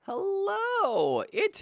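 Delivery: bright cartoonish welcome call; high sing-song host voice. Stream host greeting.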